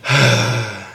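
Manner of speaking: long audible exhale; a heavy breath-out for practice; breathy sigh demo. Shown as a demonstration. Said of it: "You're just gonna be like".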